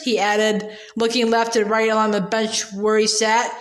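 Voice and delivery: ordinary delivery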